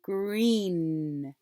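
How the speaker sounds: GREEN stretched onto the N; final sound held. Shown as a demonstration. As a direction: linger on the last N of GREEN. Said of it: "What's the final sound you captured?".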